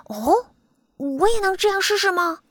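high, small creature voice; asking butterfly. A narrator's reading. Butterfly asks his friend to let him try, high. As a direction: ask eagerly, pitched high. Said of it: "里卡多问".

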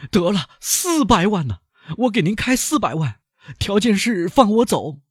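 high, urgent bargaining voice; high anxious banker making an offer. Acted. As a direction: haggle desperately for your freedom. Danglars bargains for freedom.

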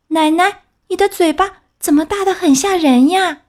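put-on high, anxious child's question; growing fear at the big mouth; frightened girl. (小红帽害怕地问，声音很高)

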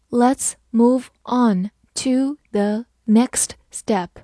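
slow, syllable by syllable; slow reading. Shown as a demonstration. Teacher reading slowly for students to follow. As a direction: read it slowly for learners. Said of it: "好，慢慢来读".

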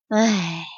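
a deflated little sigh. Acted. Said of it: "也叹了口气说". (低声叹气)

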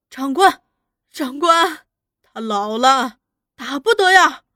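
loud, urgent begging, rising at the end; tearful pleading. A narrator's reading. Wife begging officer to spare husband, pleading. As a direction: beg desperately for mercy. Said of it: "三福娘哭着过来哀求".

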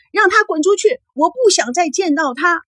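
very high, anguished cry; distraught daughter banishing a visitor; heart-rending yell. A narrator's reading. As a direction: scream your rejection, performed by a narrator. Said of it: "依然撕心裂肺地喊着".

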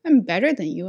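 high, mock-cocky comeback; indignant retort. Teacher's unsaid answer to the coworker, indignant. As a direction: toss back an imagined put-down.

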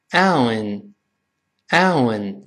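very slow "ow" closing into a nasal N; nasal ending demo. Shown as a demonstration. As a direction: stretch the diphthong, then add N. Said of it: "再加上那个字母N的声音加鼻音".

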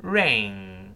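slow model "ring" ending in a long ng; velar ng ending. Shown as a demonstration. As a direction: hold "ring" on a back-of-tongue nasal. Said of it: "舌根堵死了".

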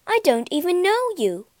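high-pitched, flustered protest; shocked. A narrator's reading. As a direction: refuse in startled dismay. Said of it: "gasped Beauty".

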